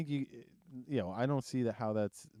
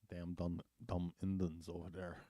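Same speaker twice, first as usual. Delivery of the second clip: low, soft mock Res accent; 4 semitones lower, 7 dB quieter than usual. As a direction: imitate a Native reservation accent, pitched down. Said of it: "they got the Res one".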